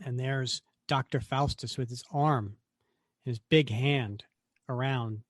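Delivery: usual speaking voice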